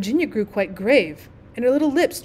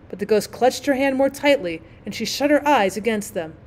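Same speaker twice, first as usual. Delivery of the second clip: ordinary delivery, nothing marked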